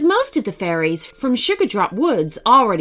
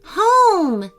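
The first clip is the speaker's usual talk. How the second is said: a higher, excited call of home; fairy spotting her home on the horizon; shouting; 10 semitones higher, 4 dB louder than usual. Acted. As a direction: call out at the first sight of home. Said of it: "shouted Capri".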